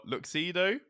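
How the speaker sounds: Luxido with "look" first and "doh" last; alternative pronunciation. Shown as a demonstration. Offering the co-host a further option, Luxido as look-see-doh. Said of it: "are you sure it's pronounced".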